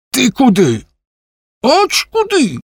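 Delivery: very high, startled outcry; high-pitched yelp of a challenge; sudden cry. A narrator's reading. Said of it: "раптом скрикнув він".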